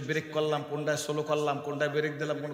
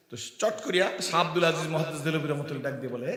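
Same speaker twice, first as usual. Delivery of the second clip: normal talking voice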